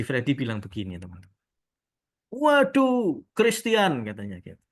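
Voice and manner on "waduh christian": high, loud retold exclamation; changed, awed tone. Haji greeting the tailor he mistakes for a star, imitated delighted exclamation. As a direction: imitate a client suddenly full of admiration. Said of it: "Nadanya udah beda".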